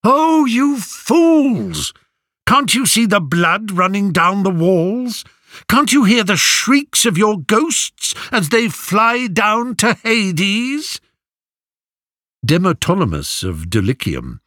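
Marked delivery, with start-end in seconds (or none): 0.0-11.0 high, slow wailing prophecy; a prophet's frantic high cry; cried out. A narrator's reading. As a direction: cry the warning like a seer in a trance. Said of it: "cried out".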